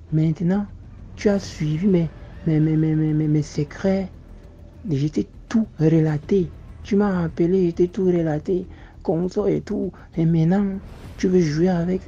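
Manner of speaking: high, loud and heated; angry accusation. Retold or imitated. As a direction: berate him for toying with you. Marabout accusing him of playing games. (reproche furieux, voix haute)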